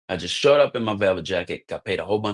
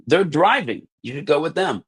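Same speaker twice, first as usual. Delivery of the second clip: much higher and louder, insistent; eager urging; 7 semitones higher, 3 dB louder than usual. Retold or imitated. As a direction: push him to jump in the van. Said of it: "He's like".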